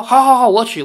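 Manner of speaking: loud, high-pitched, eager yielding; comic panicked consent; hasty agreement. Acted. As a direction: give in at once, repeating it eagerly. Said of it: "李逍遥马上说".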